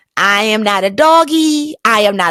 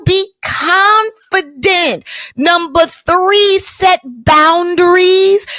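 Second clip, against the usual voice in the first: each rule drawn out slowly; slow, weighty list of rules; slow emphatic list; 5 semitones higher, much slower than usual. Shown as a demonstration. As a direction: spell out each lesson deliberately. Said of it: "listing lessons".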